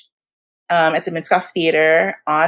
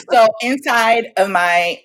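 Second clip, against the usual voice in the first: ordinary talk, nothing marked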